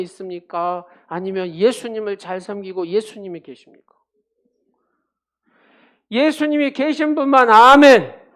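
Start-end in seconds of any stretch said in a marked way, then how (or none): 6.1-8.2 rousing call for amen. Real, not acted. Preacher calls for congregation's amen.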